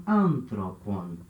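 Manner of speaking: ANthropon with the first syllable stretched and stressed; slow anthropon, accented on AN. Shown as a demonstration. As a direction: lean long on AN in anthropon. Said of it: "o acento é na terceira sílaba".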